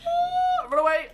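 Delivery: very high squeal into a loud shout; panicked flight. Real, not acted. Player fleeing a monster, high panicked cry. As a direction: squeal and yell while fleeing.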